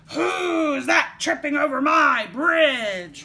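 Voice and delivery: roaring troll. A narrator's reading. Troll challenging the youngest goat on his bridge, roaring. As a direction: bellow it, stretching the words out. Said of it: "roared the troll".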